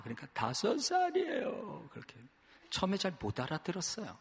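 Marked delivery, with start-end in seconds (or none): 0.3-1.9 put-on high, slow wailing child's voice; crying lost child. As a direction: sob out the age like a small kid. Lost child answering his age. (우는 아이 목소리로 높게)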